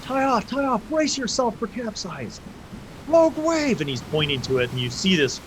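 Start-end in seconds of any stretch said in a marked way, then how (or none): 0.0-3.8 high, urgent shouted orders; rally the crew against the wave; captain shouting to the crew; captain calling out. Acted. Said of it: "he's calling everybody to tie off".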